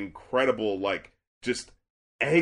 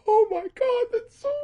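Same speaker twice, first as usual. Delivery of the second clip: very high, thin squeak, fading away; hammed-up choked-up falsetto; mock tearful. Re-listened to the song knowing its meaning, mock tearful squeak. Retelling being moved on re-listening.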